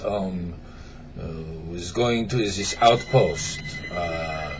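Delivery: put-on French accent. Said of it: "start over with an accent".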